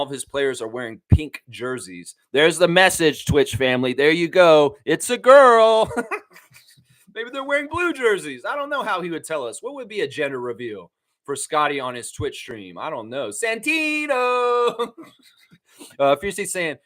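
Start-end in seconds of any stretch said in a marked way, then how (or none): 4.8-5.9 high, loud, stretched announcement; mock-triumphant whoop; gender-reveal cheer. 13.3-14.9 high, long-held name; drawn-out silly holler; playful call-out. Pretend. Playfully calling out a baby's name.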